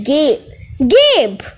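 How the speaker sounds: pleading. Acted. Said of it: "my mother pleaded".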